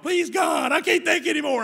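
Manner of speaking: high, loud begging wail; boy faking pain on the principal's leg, wailing; mock pleading scream. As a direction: beg at the top of your lungs, hamming it up. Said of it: "started screaming".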